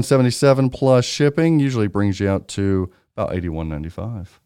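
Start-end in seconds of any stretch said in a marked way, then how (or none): none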